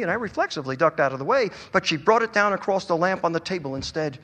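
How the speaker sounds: ordinary delivery, nothing marked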